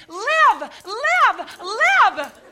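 high, loud repeated command; fervent shouted command; commanding cry. Retold or imitated. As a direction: order the dead to rise. Commanding the dead boy to live.

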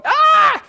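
high shrieking cartoon yelp; a hammed-up freak-out scream; mock panic scream. God pictured freaking out, a high hammed-up scream. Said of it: "God in the Godhead going".

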